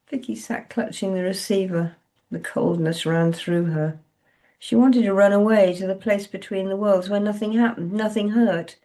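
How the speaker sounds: ordinary delivery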